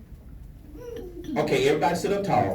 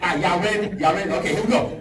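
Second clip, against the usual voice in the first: ordinary talk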